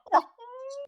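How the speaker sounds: high-pitched burst of giggles; a sudden high giggle. Real, not acted. Laughing at the memory.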